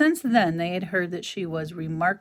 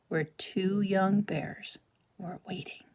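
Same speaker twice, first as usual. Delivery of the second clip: slow suspenseful whisper; 5 dB quieter, slower than usual. A narrator's reading. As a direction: hush it for the cliffhanger. Suspenseful chapter ending.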